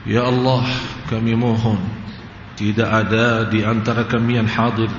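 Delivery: low, measured supplication with long pauses; prayer. Real, not acted. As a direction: lead the congregation gently in prayer. Leads a closing prayer.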